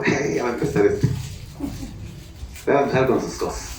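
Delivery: a hint of a wife rolling her eyes at him; wife's weary remark. Pastor imitates his wife's doubting reply, mildly put-on. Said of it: "Creo que mi esposa dijo".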